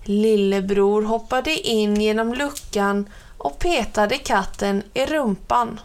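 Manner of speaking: ordinary delivery, nothing marked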